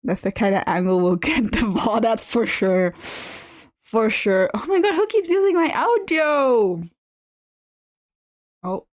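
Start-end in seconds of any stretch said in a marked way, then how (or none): 4.5-6.8 higher exasperated surprise; surprised. Real, not acted. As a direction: blurt it out in disbelief. Surprised outburst.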